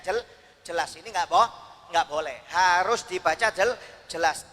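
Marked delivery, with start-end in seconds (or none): none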